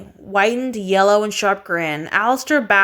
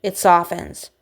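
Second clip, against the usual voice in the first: plain reading voice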